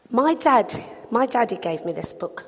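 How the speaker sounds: ordinary talk, nothing marked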